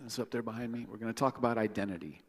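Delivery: ordinary talk, nothing marked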